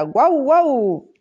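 high barking woof, each one drawn out; dog barking. A narrator's reading. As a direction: bark it out like a faraway dog. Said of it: "decía". (ladrido agudo y estirado, guau guau)

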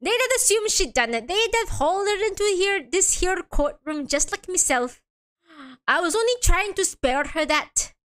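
badly faked Irish lilt; poor Irish accent. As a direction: play a defendant with a rough Irish accent. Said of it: "doesn't sound Irish at all".

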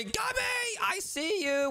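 calling out. Pretend. Spotting brother, calls his name.

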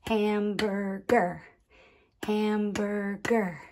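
"Hamburger" in three even beats; clapped syllables. Shown as a demonstration. Teacher clapping with children, "Hamburger" split into syllables. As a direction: clap out "Hamburger" as ham, bur, ger. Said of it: "Let's clap it".

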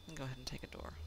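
soft unvoiced whisper. Real, not acted. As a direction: breathe it in a whisper. Whispering while gaming.

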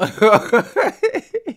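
high, loud burst of laughter. Real, not acted. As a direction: crack up loud at the link. Reacting to funny link.

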